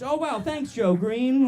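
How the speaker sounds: high, loud, hammed-up kid's gratitude; starstruck kid. Kid thanking his hero in a commercial parody, starstruck. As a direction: gush like a child in an old commercial.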